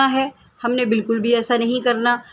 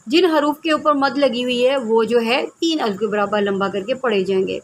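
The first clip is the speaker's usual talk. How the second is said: ordinary delivery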